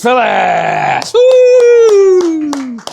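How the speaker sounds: loud, high cheer calling her name; excited shout. Real, not acted. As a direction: whoop her onto the stage. Host announces final guest.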